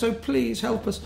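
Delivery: high-pitched mock plea; imitated pleading girl. Girl begs the band for help, imitated high pleading. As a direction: voice a girl begging for a favour, up high.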